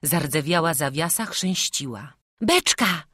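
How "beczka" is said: put-on high, loud boy's cry; urgent high-pitched exclamation; Jasiek calling out. A narrator's reading. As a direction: shout out a sudden idea. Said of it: "zawołał Jasiek".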